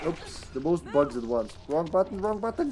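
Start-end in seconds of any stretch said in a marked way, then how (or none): none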